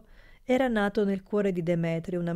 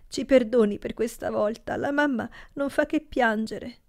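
higher, tearful pleading; 5 semitones higher than usual. A narrator's reading. Niece apologises to her uncle, tearful. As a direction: speak through held-back tears. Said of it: "con quella voce piena di lacrime".

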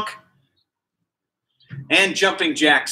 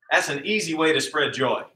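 ordinary delivery

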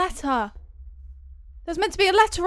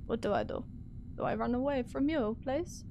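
ordinary talk, nothing marked

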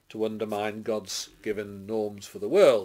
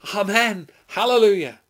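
raised, high acclamation; crying out praise; 10 semitones higher, 9 dB louder than usual. A narrator's reading. Elders worshipping before the throne, crying out praise. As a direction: cry it out in worship. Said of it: "And they cried".

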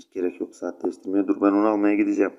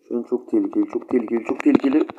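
rising alarm; 3 semitones higher than usual. Real, not acted. Danger in the match.

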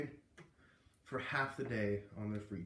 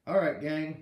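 calling the class; 3 semitones higher, 8 dB louder, slower than usual. A narrator's reading. Teacher calls class.

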